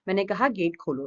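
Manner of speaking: usual speaking voice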